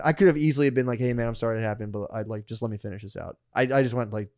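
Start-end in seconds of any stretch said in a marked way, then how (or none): 1.0-3.4 softer, lower, reasonable tone; smooth it over quietly; calm apology. Retold or imitated. Storyteller imagining the polite reply he could have given, calm and soft.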